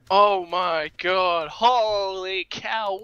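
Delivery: slow, drawn-out, loud disbelief; stunned amazement. Real, not acted. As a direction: stretch each word in real awe. Stunned caster after the game.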